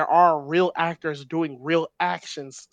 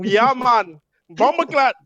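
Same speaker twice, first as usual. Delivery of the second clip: mock Jamaican patois, higher and louder; hammed-up Jamaican accent; 7 semitones higher, 6 dB louder, slower than usual. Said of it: "racist Jamaican accent".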